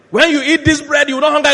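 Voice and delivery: high, loud proclamation; proclaiming preacher. Retold or imitated. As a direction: proclaim it over an excited crowd. Voices Jesus preaching to the crowd.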